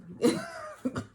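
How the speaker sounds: squeaky high burst of laughter. Real, not acted. Laughing after quote.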